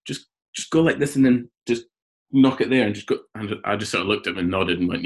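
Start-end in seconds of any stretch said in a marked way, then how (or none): 0.1-3.2 light imitation of Chanderpaul's accent, pitched up; higher-voiced take on Chanderpaul's accent. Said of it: "in his accent".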